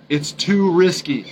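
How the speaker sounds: ordinary delivery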